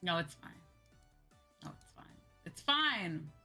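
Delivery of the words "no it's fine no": usual speaking voice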